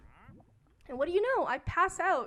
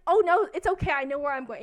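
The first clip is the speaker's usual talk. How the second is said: flustered loud exit; 11 dB louder than usual. Retold or imitated.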